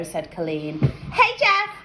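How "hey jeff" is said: calling out high across the street; a girl hollering to get his attention; shouted. Acted. Girl calls to the boy across the street, shouting. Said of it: "Laurie shouted".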